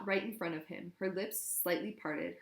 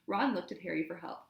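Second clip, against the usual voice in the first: ordinary delivery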